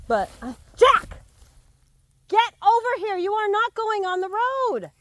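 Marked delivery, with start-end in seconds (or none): 0.8-1.2 loud, high call to the dog; shouted name. Real, not acted. Calling dog back from road. 2.3-4.9 scolding shout. Real, not acted. Ordering dog off the road.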